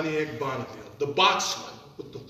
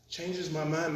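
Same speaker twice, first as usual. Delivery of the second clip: ordinary talk, nothing marked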